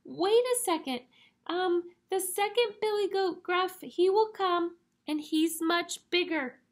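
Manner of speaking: high-pitched little goat; very small voice. Acted. Tiniest goat bargains with the troll, small voice. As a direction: keep it high and tiny throughout. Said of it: "in his very small voice".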